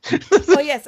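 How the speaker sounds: usual speaking voice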